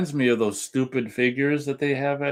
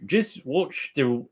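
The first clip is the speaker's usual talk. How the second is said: slow, higher, tentative Irish accent; attempted Irish accent; 4 semitones higher, slower than usual. Shown as a demonstration. Co-host asked to read a viewer's message, shaky attempt at Irish accent. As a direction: try an Irish accent, slowly. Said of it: "Do an Irish accent".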